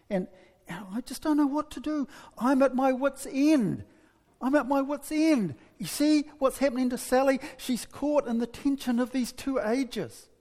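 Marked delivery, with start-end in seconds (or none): 0.1-8.3 acted desperate venting; at wit's end. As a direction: wail it mildly, as a mother out of answers. Acted mother at her wit's end.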